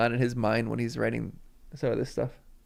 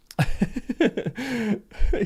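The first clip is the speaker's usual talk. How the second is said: teary laugh; 9 semitones higher than usual. Real, not acted. Laughs after admitting she cried.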